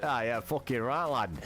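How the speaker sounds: hammed-up British lad accent, lightly done; troll as a cheeky British bloke; fake British accent. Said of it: "I'm fucking talking like him".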